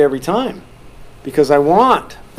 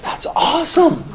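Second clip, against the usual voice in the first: amazed; 11 semitones higher than usual. Retold or imitated. Amazed at kids' manners.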